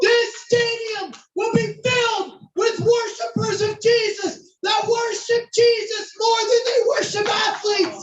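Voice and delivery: high, slow, shouted declaration with drawn-out words; fervent prophesying. Retold or imitated. Standing on chairs prophesying over the stadium, high impassioned shout. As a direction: proclaim it over a whole stadium at full force. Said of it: "we begin to prophesy over the stadium".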